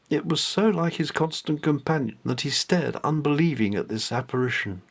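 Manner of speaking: ordinary delivery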